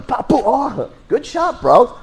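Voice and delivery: high, teasing compliment as he lands punches; mock cheerful praise. Fighter praising his opponent while punching him, high and teasing. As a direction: taunt the opponent with fake admiration.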